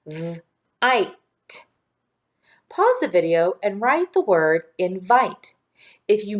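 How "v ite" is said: "V, ite" broken into separate sounds, v held alone; sounding out. Shown as a demonstration. Teacher tapping the sounds of vite, sounding out. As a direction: isolate the sounds in "V, ite" with pauses. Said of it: "Tap those sounds".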